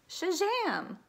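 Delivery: high, loud burst of delight; a bright cheer of Shazam; excited exclamation. Acted. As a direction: shout it like a magic trick. Storyteller's magic exclamation.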